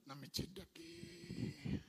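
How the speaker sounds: slow, quietly voiced inner voice of a woman; lightly acted, slow and guarded; a hint of a defensive woman, slow; voicing her inner thoughts. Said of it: "o que é que ela tá dizendo".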